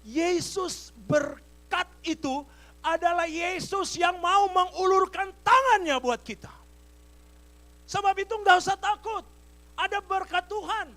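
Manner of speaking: loud, high, emphatic proclamation; preaching at full force. Real, not acted. Preacher assures congregation of God's blessing, forceful preaching.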